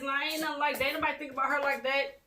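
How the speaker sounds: retold defensive insistence; over-the-top denial. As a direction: act out someone protesting too much.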